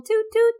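high little horn toots; playful toot toot. Pretend. Co-host boasting of her decorating, high horn imitation.

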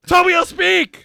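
loud high shouted impression; shouted mock German speech; mock German shouting. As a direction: yell it like a ranting Nazi. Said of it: "they're like".

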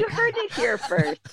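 high-pitched, through real laughter; giggly, pitched well up; laughing. Real, not acted. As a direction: crack up while saying it. Joking announcement amid shared laughter.

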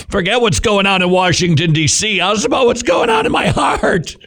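high-pitched outburst building to a squeal; exclaiming. Acted. Mock outburst after laughing at himself.

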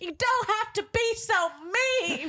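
high, slow, whiny mock sob; mock crying whine. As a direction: imitate her tearful complaint. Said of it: "she's crying".

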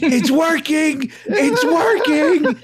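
put-on high, slow amazed kid; thrilled kid. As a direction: savor it like a delighted child. Kid voiced in story.

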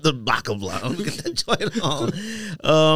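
mock line broken up by laughter; can't keep a straight face finishing the joke; laughing through the mock quote.

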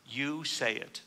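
YOU leaned on, then the rest dropping away; stress on YOU. Acted. Teacher quoting Yeshua's reply to Pilate, stress on YOU. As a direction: put the weight on the word You. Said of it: "with the emphasis on you".

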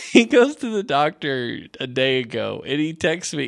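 usual speaking voice

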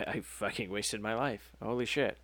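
hushed, deflated mock confession; quiet acted despair; resigned confession. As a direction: say it low and spent, as a grim realization. Acted confession ending his documentary.

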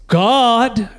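half-shouted, drawn-out call; mild mock shout. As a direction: imitate a child calling out into a dark room.

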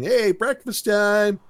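mild sing-out call to the group; retold yell. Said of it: "there Eric's yelling".

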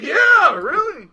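high, loud "yeah, really"; exasperated, high-pitched confirmation; emphatic comeback. Retold or imitated. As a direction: throw it back loudly. His pointed comeback retold.

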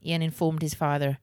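usual speaking voice

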